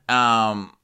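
ordinary talk

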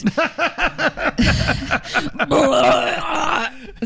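giddy burst of laughter; laughing hard, can't stop; excited laughter. Real, not acted. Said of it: "I just got so excited".

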